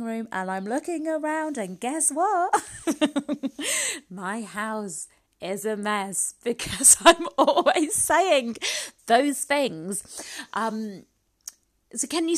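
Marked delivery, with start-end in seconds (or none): 2.6-4.1 high burst of laughter; real laugh at oneself; amused laugh. Real, not acted. Host looking round her messy living room, self-amused laugh. 4.1-9.9 loud, slow, laughing through the words; real laughter breaking into a confession; laughing admission. Real, not acted. Host owning up to her messy-house habit, laughing loudly.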